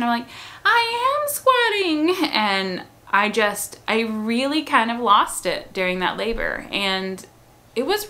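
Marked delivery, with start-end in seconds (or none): 0.6-2.3 much higher, slow, exasperated protest; retold raised voice. Mother in labor protesting to midwife, exasperated high outburst. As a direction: snap it back, drawn out and high. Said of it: "raised my voice at my midwife".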